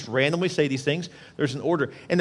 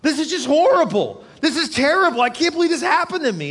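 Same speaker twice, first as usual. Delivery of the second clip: pitched up high, a laugh breaking into the lament; venting the hurt; 11 semitones higher, 3 dB louder than usual. Retold or imitated. Preacher voicing a hurting churchgoer's lament. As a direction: let it all out, higher and louder. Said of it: "a moment where you just say".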